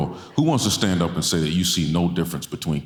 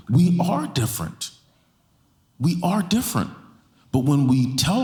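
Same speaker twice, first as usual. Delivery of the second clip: impassioned preaching; 9 semitones higher than usual. Acted. Pastor pressing the church to embrace differences, impassioned preaching. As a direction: preach it home, each phrase climbing higher. Preacher drives the point home.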